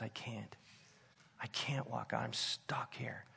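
quiet, whispered plea from the bar; half-whisper, defeated, asking for rescue; hushed plea. Retold or imitated. Friend on the phone begging to be picked up, whispering.

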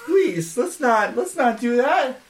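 loud, high pleading call. Acted. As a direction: call out to stop the fight. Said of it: "kinda just call out".

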